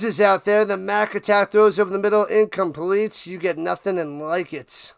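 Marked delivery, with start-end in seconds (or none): none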